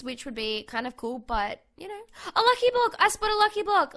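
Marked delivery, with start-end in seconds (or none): 2.3-3.9 much higher and louder announcement; excited shout. Real, not acted. Telling viewers she spotted a lucky block, excited shout. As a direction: call it out thrilled.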